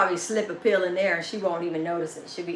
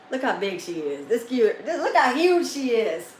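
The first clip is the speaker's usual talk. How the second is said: ordinary delivery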